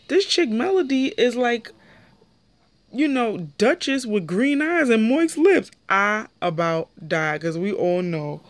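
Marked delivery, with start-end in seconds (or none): 0.1-5.7 higher, amused retelling voice; lightly higher gossip imitation; imitating Sky. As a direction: quote her like juicy gossip. Sky's confessional quote.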